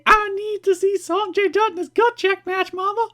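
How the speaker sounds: put-on Southern accent. Host playing a Nashville fan begging Mama, Southern accent. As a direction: put on a high, pleading Southern voice. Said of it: "Why are you Southern?".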